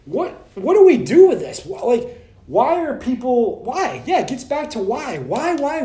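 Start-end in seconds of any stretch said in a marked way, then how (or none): 0.0-4.0 exasperated questioning. Retold or imitated. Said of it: "I'm like".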